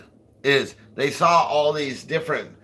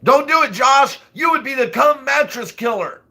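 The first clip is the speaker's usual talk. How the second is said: high, loud playful yell at Josh; mock shouted warning; 14 semitones higher, 7 dB louder than usual. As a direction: shout it like a frantic warning to a friend.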